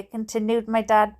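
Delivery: usual speaking voice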